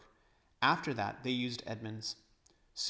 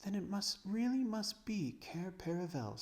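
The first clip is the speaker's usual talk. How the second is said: put-on awed girl; 9 semitones higher than usual. Lucy awed realization.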